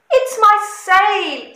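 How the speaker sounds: a bleating, woeful goat; upset goat. A narrator's reading. Goat telling George about the lost sail, plaintive.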